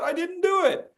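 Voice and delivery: put-on high, pleading kid voice protesting innocence; child's high whiny denial; whiny little boy. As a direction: play a boy denying he did it. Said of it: "the young boy says".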